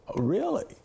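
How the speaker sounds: high, rising, teasing "really?"; a playful, sceptical pitch jump; mock disbelief. Telling a Nobel laureate, with high mock disbelief. Mock doubt at Leon's claim.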